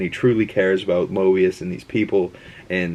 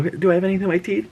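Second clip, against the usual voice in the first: much higher, fast fretting; nervous mirror check; 11 semitones higher, much faster than usual. Retold or imitated. Loki checking his teeth in the mirror, high and fast. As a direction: rush a quick anxious check, higher. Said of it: "You look in the mirror".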